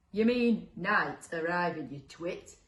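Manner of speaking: slow, scornful character correction; lightly voiced grumpy monster; monster calling back. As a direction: correct them slowly and loudly, then drop off. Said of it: "called back the second monster".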